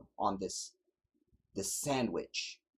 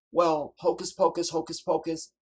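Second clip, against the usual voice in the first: high, loud sarcastic hocus pocus; mocking scoff; 7 semitones higher, 7 dB louder than usual. Real, not acted. Preacher mocking the plane crashes, with a loud sarcastic scoff. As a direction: sneer it like a magic trick.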